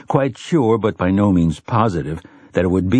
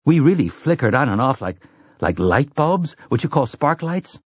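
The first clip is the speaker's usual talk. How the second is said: lightly voiced boy, pitched higher; a hint of a boy puzzling it out, higher; curious Jake; 6 semitones higher than usual. Boy asking the gunslinger about vanishing, lightly voiced and curious.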